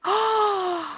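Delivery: high, drawn-in gasp; sharp excited gasp. Acted. Girl hearing of the Goblin Market, excited gasp. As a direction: gasp in mock amazement.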